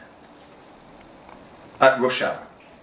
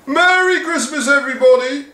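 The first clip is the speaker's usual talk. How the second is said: very high, loud cheery greeting; doddery head wishing everyone joy; confused old headmaster; 5 dB louder than usual. Acted. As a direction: announce it merrily to the din. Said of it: "announced Mister Wilson".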